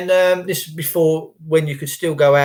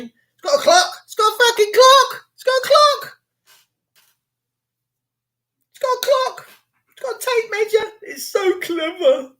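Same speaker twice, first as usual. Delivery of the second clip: squeaky, much higher, near-crying hysteria; building to a high mock panic. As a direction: wind yourself up into a shrill mock panic.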